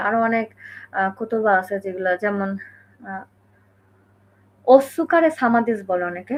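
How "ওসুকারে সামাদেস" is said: "ওসুকারে" slowly with the "সু" fully voiced; learner's "ওসুকারে", every vowel sounded out; full su, mistaken. Shown as a demonstration. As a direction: spell out the u in "ওসুকারে". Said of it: "ওইটা কিন্তু আসলে ওসুকারে সামাদেস না".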